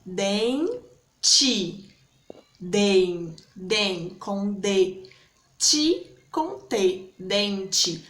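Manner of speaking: "dente" split into two separate beats; broken into syllables. Shown as a demonstration. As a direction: say "dente" syllable by syllable, den, then te. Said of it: "escrita da letra é diferente".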